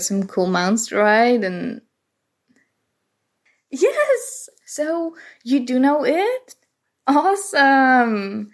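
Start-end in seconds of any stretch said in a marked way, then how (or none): 3.7-6.5 sudden delighted yelp, much higher; thrilled squeal of recognition; excited. Acted. Guest knows her favourite game. 7.1-8.5 excited. Acted. Guest knows her favourite game.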